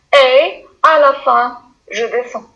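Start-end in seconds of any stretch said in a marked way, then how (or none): none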